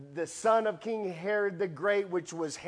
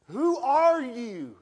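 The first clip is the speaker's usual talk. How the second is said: startled cry; 7 semitones higher, 7 dB louder, slower than usual. Retold or imitated. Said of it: "And Saul goes".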